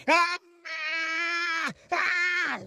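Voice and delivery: high, sustained wail; long pained scream. Retold or imitated. Boy's hand held over the flame, pained scream. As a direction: scream out in burning agony.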